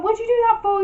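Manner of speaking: he can't believe she threw the ring; shocked outburst. Retold or imitated. Boyfriend shocked as she throws the expensive ring in the sea, high and loud.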